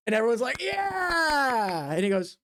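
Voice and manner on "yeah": crowd cheer. Acted. Everyone cheers.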